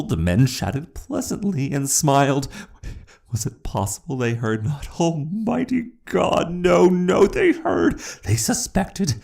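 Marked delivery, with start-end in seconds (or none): 5.0-9.2 much higher, cracking panic; terrified realization. A narrator's reading. Narrator realizing the officers know, terrified. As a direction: gasp it in horror.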